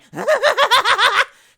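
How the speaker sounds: high, loud mad laugh from a straitjacket; maniac cackle. Pretend. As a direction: cackle like a blood-covered lunatic. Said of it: "he's in a straitjacket going".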